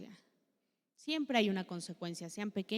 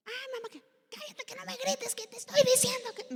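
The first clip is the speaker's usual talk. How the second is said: put-on higher, shrill-free teen yell back at mom; child yelling back; 4 dB louder than usual. Rebellious child shouting back at mother, high angry yell. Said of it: "¿ellos nos gritan a nosotros también?".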